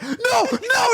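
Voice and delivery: high mock-desperate shout; panicked shout. Acting out Chris trying to grab him, high panicked shout. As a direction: imitate someone yelling as he loses his grip.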